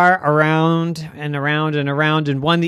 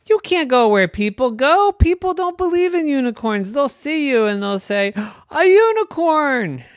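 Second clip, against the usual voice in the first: high, worried cartoon dad; a father warning his child; alarmed daddy unicorn; 12 semitones higher, 5 dB louder than usual.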